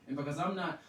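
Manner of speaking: ordinary talk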